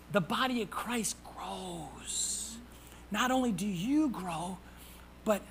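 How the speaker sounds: slow, hushed whisper. Real, not acted. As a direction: let it land in a slow whisper. Preacher whispers the key line.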